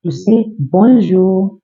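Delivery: bonjour said slowly and clearly. Shown as a demonstration. Teacher slowly saying bonjour to students. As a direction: sound out bonjour for the class. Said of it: "how to say hello in French".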